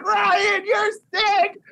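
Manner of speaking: high, strained cry of disbelief; excited cry. Retold or imitated. Fan crying out to the director, strained high cry. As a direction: cry out like it hurts.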